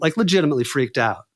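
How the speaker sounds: ordinary delivery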